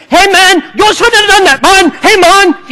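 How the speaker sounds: loud, high-pitched mock Jamaican accent; upset Jamaican. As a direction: holler like a comically upset Jamaican. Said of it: "a Jamaican isn't really upset even when he's upset".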